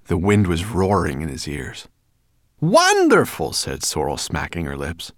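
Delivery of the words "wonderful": loud, high, drawn-out delight; relishing. A narrator's reading. Sorrel smacking her lips over food, relishing. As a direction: savour it noisily.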